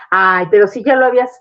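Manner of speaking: parent's exasperated voice, rising in pitch. Pretend. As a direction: scold the child for being sad again. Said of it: "cometer el error de decir".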